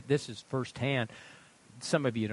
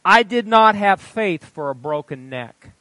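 higher, loud pulpit delivery; preaching read-aloud; 7 semitones higher, 14 dB louder than usual. A narrator's reading. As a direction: declare it forcefully to the congregation. Reads testimony at full force.

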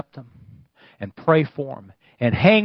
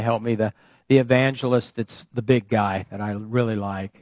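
usual speaking voice

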